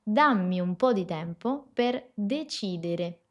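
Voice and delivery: "Dammi un po' di tempo per decidere" slowly, each D dental; slow Italian model sentence. Shown as a demonstration. Teacher modelling the next practice sentence, slow and careful. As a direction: read it slowly, tongue behind the upper teeth. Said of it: "Vamos con la que sigue".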